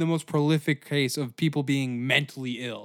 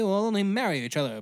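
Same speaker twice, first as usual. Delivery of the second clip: ordinary talk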